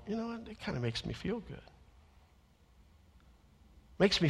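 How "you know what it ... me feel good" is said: very soft, confiding aside; hushed little private admission; quiet aside. Retold or imitated. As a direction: drop right down as if confiding in a colleague.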